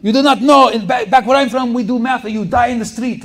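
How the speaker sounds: loud, high mock Ukrainian professor ranting; Ukrainian accent. Intense Ukrainian professor berating the class, mock loud Ukrainian accent. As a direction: yell at dumb Americans like the intense Ukrainian professor. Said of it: "He's like".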